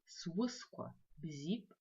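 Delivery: Свысква бзип drawn out very slowly; slow choral lead; very slow model word. Shown as a demonstration. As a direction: crawl through Свысква бзип for the class. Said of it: "прочитаем все вместе".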